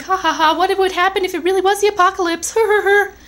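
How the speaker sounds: high, scoffing mock laughter. Vlogger retells mocking the apocalypse, high and laughing. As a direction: laugh it off like a smug hater. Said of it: "I was laughing and being all, like, a hater".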